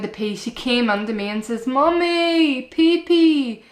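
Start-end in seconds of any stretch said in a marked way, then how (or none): 1.7-3.6 put-on high, louder little-girl call; imitated toddler. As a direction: pipe it up like a toddler who needs the potty. Mum voicing toddler asking to pee.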